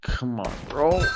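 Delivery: ordinary talk, nothing marked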